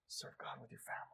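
soft breathy whispered close. Real, not acted. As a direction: whisper the call to serve. Fading close of sermon.

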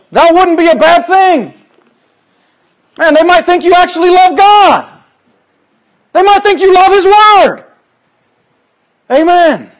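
high, loud exultant preaching; shouted amens. Acted. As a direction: rouse the church with each amen. Preaching at full force.

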